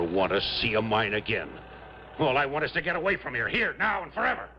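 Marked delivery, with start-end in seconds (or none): 2.2-4.5 rising high, desperate to get away; desperate outburst. Acted. Miner begging the officer to let him leave, rising high. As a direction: plead to leave this place forever.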